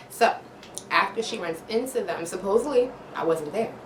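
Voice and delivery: normal talking voice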